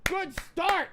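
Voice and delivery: very high, put-on exclamation; high-pitched sarcastic self-congratulation; mock cheer. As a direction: squeal it in a silly high voice. Mock cheer after flubbed intro.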